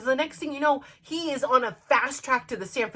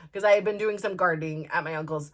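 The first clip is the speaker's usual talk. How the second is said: usual speaking voice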